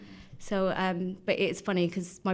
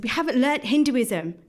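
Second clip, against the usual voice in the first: put-on upset child; 6 semitones higher, 7 dB louder than usual. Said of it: "he got really upset".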